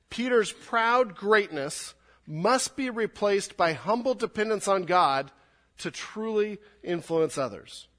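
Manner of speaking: slow, every word weighted, PROUD punched; slow emphatic reread. Shown as a demonstration. Preacher rereading his point slowly for emphasis. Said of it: "Let me read it again".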